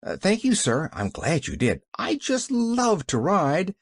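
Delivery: put-on lilting, eager girl's gratitude; delighted, drawing out the pleasure; beaming delight. A narrator's reading. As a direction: lean happily into it, pitch leaping up. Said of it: "beamed Pollyanna".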